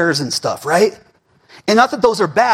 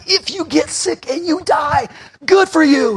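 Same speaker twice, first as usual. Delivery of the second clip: preaching shout; 8 semitones higher than usual. Acted. Pastor tells the congregation death can't beat them, shouting. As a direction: fling it out at the congregation.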